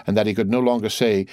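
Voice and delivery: normal talking voice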